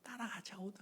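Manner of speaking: soft unvoiced whisper; inner whisper. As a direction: think it to yourself. (속으로 속삭이듯)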